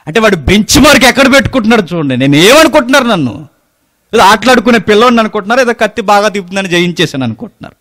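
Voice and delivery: high, loud, fast preaching; fiery preaching. Acted. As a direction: drive the point home to the congregation. Preaching at full force. (ఉద్రేకంగా బిగ్గరగా ప్రసంగం)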